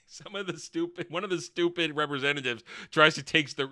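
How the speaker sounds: normal talking voice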